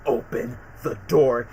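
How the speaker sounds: low, slow cold anger; pissed off. Retold or imitated. Peter ordering the scientist, low and slow anger. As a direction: say it through tight restraint. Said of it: "how super pissed Peter was".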